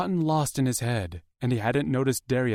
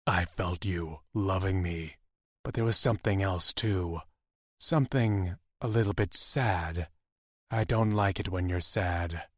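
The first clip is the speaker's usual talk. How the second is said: a hint of a deep, protective mate; soft and concerned, lightly voiced man; low tender lover voice; 3 semitones lower, 4 dB quieter than usual. A narrator's reading.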